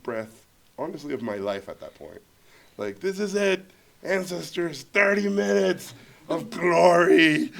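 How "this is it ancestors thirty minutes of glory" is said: grand invocation. Retold or imitated. Rallying himself before the pitch.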